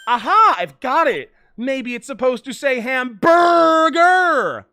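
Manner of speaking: loud, high triumphant shout; mock eureka. [name] shouting her hamburger guess. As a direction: shout out the silly guess like a genius.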